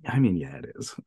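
lightly imitating Leone, trailing off quietly; mock Leone reply. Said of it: "Leone was like".

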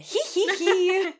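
high, teasing "hee hee hee"; mock gleeful giggle. Gloating friend who got away with the kill, mock giggle.

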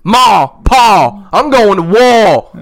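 high, loud farmboy holler, drawn out; calling to parents. Acted. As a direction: announce it proudly across the farm. Recruit calling to his parents.

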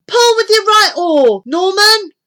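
high, loud urgent shout. A narrator's reading. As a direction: yell orders across a boat in danger. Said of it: "shouted Debbie".

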